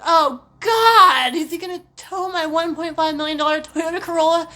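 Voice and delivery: loud mock-frantic worrying; mock panic. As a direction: spiral into hammed-up dread. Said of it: "I'm like".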